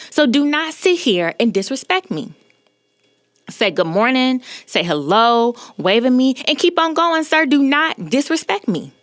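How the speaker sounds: high-pitched indignant telling-off; re-enacted indignant lecture, pitched high; retold scolding. Retelling her telling-off of the man.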